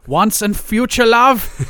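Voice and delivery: slow, lightly German-tinged Freud musing; a hint of a mock German psychoanalyst, drawn out; German accent. Host slips into a mock Freud predicting Brady's downfall, light German accent. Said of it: "I slipped into a weird Sigmund Freud there".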